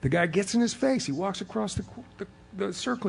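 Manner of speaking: ordinary delivery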